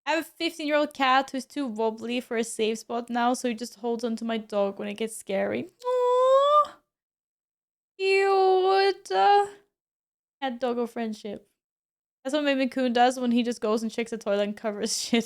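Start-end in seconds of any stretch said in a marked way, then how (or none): 5.9-9.1 much higher, louder cooing over something cute; cooing aww. Real, not acted. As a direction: gush over the cute pets. Cooing at cat and dog friendship.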